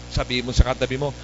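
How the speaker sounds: ordinary talk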